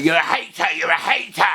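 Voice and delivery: mild mock yell of critics; mock accuser. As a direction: fling the accusation back. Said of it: "if you hammer it".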